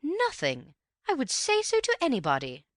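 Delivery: high, loud protesting mother; insistent mother. A narrator's reading. Mother vouches for Angela to Antony, high and loud. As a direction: protest too much.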